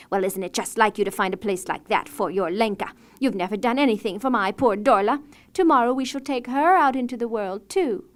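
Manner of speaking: loud complaint. A narrator's reading. Stepmother complaining to husband, loud and resentful. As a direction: grumble loudly and jealously. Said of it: "began to complain".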